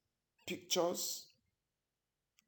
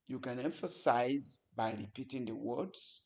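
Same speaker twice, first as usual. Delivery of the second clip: ordinary talk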